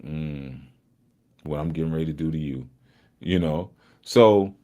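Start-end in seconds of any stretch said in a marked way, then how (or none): 0.0-2.7 menacing smile. Retold or imitated. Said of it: "smiling 'cause it's like".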